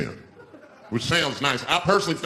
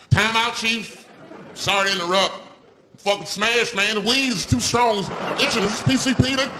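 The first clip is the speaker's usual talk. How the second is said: rising, strained panic, much higher; panicked high voice; 9 semitones higher than usual. Retold or imitated. Stoned comedian panicking to the chief, high-pitched. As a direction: freak out like the spirits have you.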